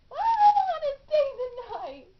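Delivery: high, loud crying wail; a loud, high tearful protest. Acted. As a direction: wail it out through tears. Frightened girl breaks down crying.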